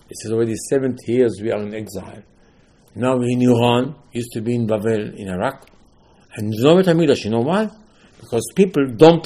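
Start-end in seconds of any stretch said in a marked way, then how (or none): none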